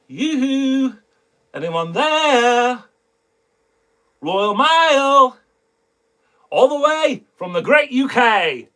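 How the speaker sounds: high, drawn-out mock UK accent; teaser in UK accent. Shown as a demonstration. Teasing viewers about upcoming mail, put-on UK accent. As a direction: call it out high and slow in a put-on British accent. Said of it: "that's my little teaser pleaser".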